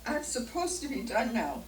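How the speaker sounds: fighting back real tears with a laugh; tearful laughter. Real, not acted. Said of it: "oh, here we go, I'm done".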